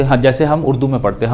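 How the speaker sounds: ordinary delivery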